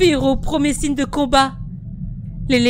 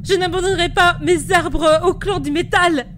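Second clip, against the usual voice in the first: raised, higher-pitched protest; defiant; 5 semitones higher than usual. Acted. Priestess will not abandon her trees, defiant outcry. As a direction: refuse with fierce indignation.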